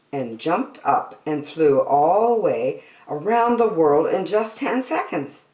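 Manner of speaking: ordinary delivery, nothing marked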